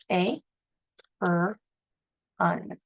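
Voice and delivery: "A, er, un." split into separate syllables; "A, er, un." sounded out for a child. Shown as a demonstration. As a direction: break "A, er, un." into slow pieces. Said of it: "sounding out apron".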